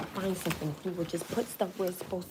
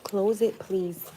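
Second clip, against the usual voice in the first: louder repeat; 10 dB louder, much slower than usual. Real, not acted. Mom repeats her request to her son, louder and impatient. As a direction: call it out louder the second time.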